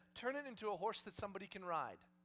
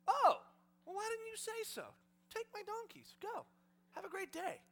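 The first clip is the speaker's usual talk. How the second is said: high-pitched, breezy mock delight; over-obliging owner; 12 semitones higher, 5 dB louder than usual. Donkey owner happily giving them away, hammed-up obliging. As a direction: hand it over with sing-out cheer.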